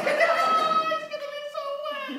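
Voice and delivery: very high squeal through laughter; laughing too hard. Acted. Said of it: "I'm laughing so hard".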